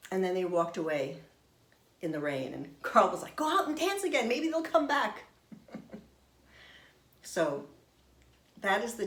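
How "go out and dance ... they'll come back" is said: eager urging. Retold or imitated. Husband urges her back out.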